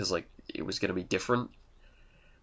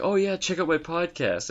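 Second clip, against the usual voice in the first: high-pitched pretend plug; mock eager pitch; 9 semitones higher than usual. Fan meeting actor on street, high mock plug.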